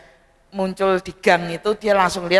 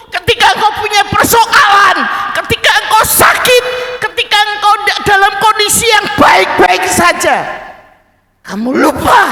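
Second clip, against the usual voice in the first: fiery preaching; 13 semitones higher, 7 dB louder than usual. Acted. Preacher driving home a child's love for his father, loud fervent preaching. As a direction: pound each phrase home from the pulpit.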